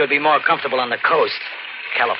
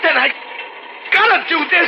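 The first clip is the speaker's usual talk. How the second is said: desperate shout. Acted. Drifter warning the madman before stabbing, desperate shout. About to stab him.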